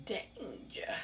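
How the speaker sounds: breathy hushed warning; whisper. Pretend. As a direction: whisper it like a secret. Mock warning while eating.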